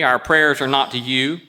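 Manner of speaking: usual speaking voice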